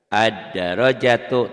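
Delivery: Ad-darajatu chanted slowly and loudly, doubled d; recited Arabic example. Shown as a demonstration. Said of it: "lamnya nggak dibaca ya". (Ad-darajatu dibaca pelan, lam tidak dibaca)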